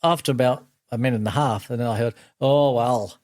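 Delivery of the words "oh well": light Yorkshire imitation; mild Yorkshire accent of an old mate. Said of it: "I didn't realise Neil was a Yorkshireman".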